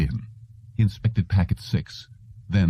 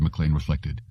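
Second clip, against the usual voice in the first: ordinary delivery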